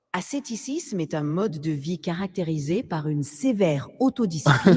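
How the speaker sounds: spoken in French. Pretend. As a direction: deliver it in French. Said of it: "your French accent".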